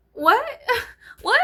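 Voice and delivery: high, loud squeal of disbelief; what? shot up high, laughing; stunned by the flat-footed ducks; shocked disbelief. Retold or imitated.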